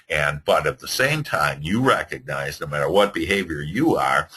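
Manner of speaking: normal talking voice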